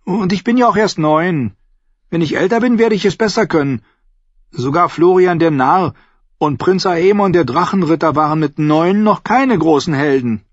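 put-on high, whiny boyish voice; whining boy. A narrator's reading. Bran excusing himself to Jojen, high whine. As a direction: whine it like a nine-year-old.

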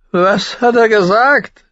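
very high, loud, slow question; agitated demand. A narrator's reading. Brother demanding a translation, high loud agitated cry. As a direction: demand it like a twitchy madman. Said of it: "zuckte dabei".

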